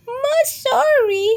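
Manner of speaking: tiny high mouse voice; squeaky little mouse. Acted. Mouse apologizing to Bear, high squeaky voice. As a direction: pipe it up like a small mouse.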